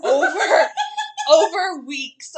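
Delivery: slow, stretched words broken by laughter; laughing as she spells it out; laughing advice. Retold or imitated. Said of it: "You have to say, like".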